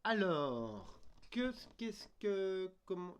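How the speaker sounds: a big, long puzzled "euh, alors"; loud slow thinking aloud; loud drawn-out hesitation. Real, not acted. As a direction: stretch the fillers out loud and high. Puzzling aloud, long stretched filler.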